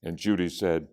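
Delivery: plain reading voice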